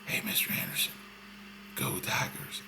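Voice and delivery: whispered, like telling a secret; hushed secret whisper. Shown as a demonstration. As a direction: whisper it softly into someone's ear.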